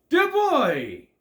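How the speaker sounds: high, loud theatrical wizard call; a hammed-up sky wizard calling down; booming wizard.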